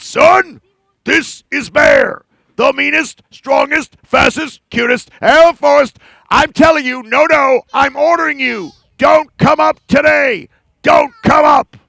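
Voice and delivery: loud, angry bear ordering the sun; furious bragging bear; angrier bear. Acted. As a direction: bellow like an angry bully.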